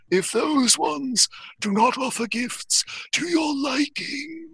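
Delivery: otherworldly high voice, words stretched; Barmy. Acted. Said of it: "Barmy says".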